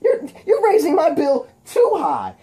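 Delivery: high, loud squeaky taunting shout; cartoonish high-pitched scolding of a foe; Spider-Man. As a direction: yell the wisecrack way up high. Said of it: "And Spider-Man goes".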